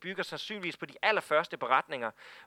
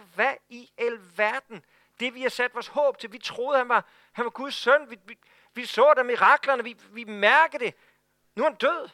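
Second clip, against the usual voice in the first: dismayed disbelief; 4 semitones higher, 5 dB louder than usual. Retold or imitated. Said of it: "De tænker".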